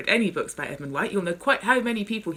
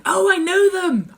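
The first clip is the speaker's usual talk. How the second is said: high, loud, eager cut-in; excited interruption; 11 dB louder, slower than usual. Acted. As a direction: blurt it out with excitement. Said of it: "I interrupted excitedly".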